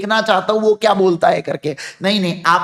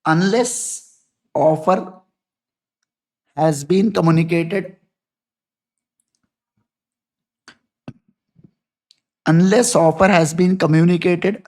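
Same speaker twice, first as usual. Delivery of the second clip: slow, word by word so students can write; slow dictation; 2 semitones lower, much slower than usual. Shown as a demonstration. Teacher dictating notes to students, slow and deliberate. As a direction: dictate each phrase slowly to the class.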